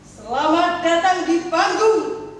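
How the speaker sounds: big stage introduction with a rising, drawn-out finish; showman's welcome. Acted. False-teeth maker welcomes the audience to his stage, showman-style. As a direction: announce the act loudly, stretching the last words.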